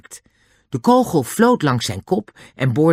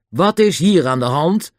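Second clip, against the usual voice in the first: raised, authoritative question; demanding; 5 semitones higher, 4 dB louder than usual. A narrator's reading. The front officer confronts the scene, demanding. Inspector demands explanation on arriving.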